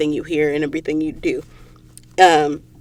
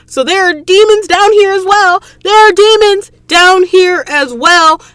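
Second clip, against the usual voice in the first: high, loud, dramatic reveal of demons; excited outburst; 12 semitones higher, 7 dB louder than usual. Real, not acted. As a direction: shout it out, thrilled and shocked.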